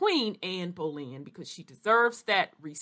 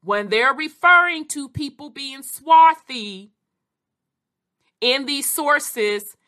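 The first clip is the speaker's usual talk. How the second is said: pointed, emphatic; 5 semitones higher, 6 dB louder than usual. Real, not acted. Lecturing those who play dumb.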